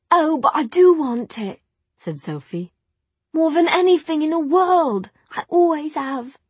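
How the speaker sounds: put-on high, eager servant girl voice; lightly voiced young maid wishing; earnest young maid. Sophie voiced in story.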